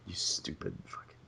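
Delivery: hissed under the breath; whispered curse. Real, not acted. Venting about the office lady, a whispered curse. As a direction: whisper the insult to yourself.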